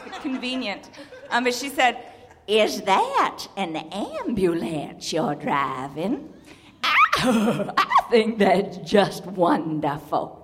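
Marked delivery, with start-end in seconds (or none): 2.4-10.4 slow Plantation Southern drawl; unhurried, drawn-out Plantation Southern lady; Plantation Southern accent. Retold or imitated. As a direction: imitate a Southern woman, stretching the vowels right out. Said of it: "my favorite is Plantation Southern".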